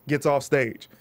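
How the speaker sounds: normal talking voice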